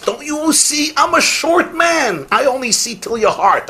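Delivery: put-on a kindly old rabbi's light, high voice; gentle rabbi. Old rabbi gently answers student.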